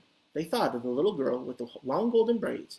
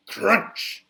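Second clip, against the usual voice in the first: crunch; 4 semitones higher, 6 dB louder than usual. Acted. Chair breaking sound effect.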